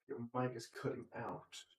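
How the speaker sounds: normal talking voice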